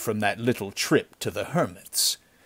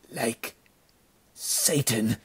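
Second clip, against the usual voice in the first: weak, soft words with a long gap; dying, trailing off; 4 dB quieter, slower than usual. A narrator's reading. Dying bandit's last answer to his chief, fading. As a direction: let the voice fade out as life goes. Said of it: "The voice trailed off in silence".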